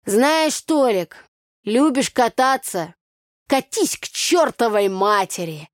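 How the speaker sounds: loud, high, slow village scolding; scolding yell. Acted. Tanya yelling at drunk Tolik. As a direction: tell him off at full voice. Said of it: "А я ему".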